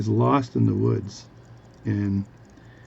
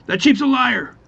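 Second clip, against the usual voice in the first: very high, loud punchline; alarmed farmer's outburst; 13 semitones higher, 7 dB louder than usual. Acted. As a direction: blurt it out in mock outrage.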